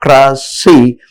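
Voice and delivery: "democracy" broken into de, mo, cra, cy; syllable by syllable. Shown as a demonstration. Teacher counting syllables for learners, "democracy" said syllable by syllable. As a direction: spell out "democracy" in four separate beats. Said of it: "there are four syllables included".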